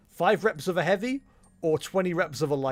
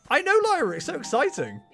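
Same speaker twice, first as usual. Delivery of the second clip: mock excited; 9 semitones higher, 3 dB louder than usual. Mock excited reply to character.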